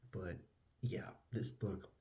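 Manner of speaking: normal talking voice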